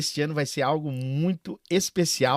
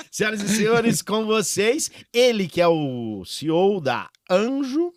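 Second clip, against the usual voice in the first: grand entrance announcement; 5 semitones higher than usual. Acted. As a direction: build it up like a stage host. Host announces the guest. (anúncio de grande entrada)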